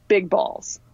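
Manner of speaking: reluctant, deadpan admission; embarrassed deadpan reveal; eye-roll delivery. Retold or imitated. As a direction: say it like you're rolling your eyes. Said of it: "insert my eye roll here".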